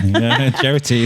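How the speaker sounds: slow "Gerrity" said through a laugh, falling; amused slow "Gerrity"; laughing repeat. Real, not acted. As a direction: laugh out the mangled name "Gerrity". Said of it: "So now you've changed gender".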